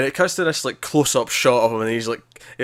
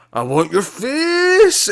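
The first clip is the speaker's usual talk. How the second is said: high, loud, slow drawn-out cry; high, loud, stretched-out creepy yell; crazed menacing cry; 12 semitones higher, 5 dB louder, much slower than usual. Retold or imitated. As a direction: lurch at her like a tripping madman. Retells tripping character lunging.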